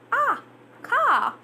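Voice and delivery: a British "car", open "ah" and no r. Shown as a demonstration. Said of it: "British, then it would be car".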